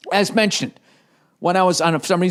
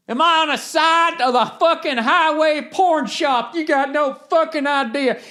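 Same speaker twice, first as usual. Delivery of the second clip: trucker voice; 12 semitones higher than usual. Retold or imitated. Trucker telling his boss he won't be seen, swaggering. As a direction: brag like a free-roaming driver. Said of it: "You get into trucking".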